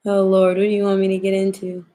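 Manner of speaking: ordinary talk, nothing marked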